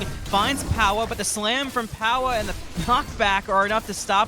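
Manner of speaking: play-by-play building to a roar; rising hype, shout the big moments; fighting game announcer. Real, not acted.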